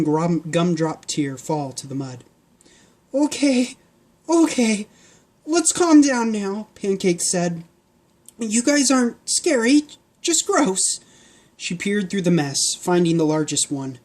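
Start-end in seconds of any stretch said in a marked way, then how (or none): 3.1-6.7 put-on high, brisk girl calming a crowd; high girl's voice calming the pigs; girl Pancake voice. 8.4-11.0 put-on high, brisk girl calming a crowd; high girl's voice calming the pigs; girl Pancake voice.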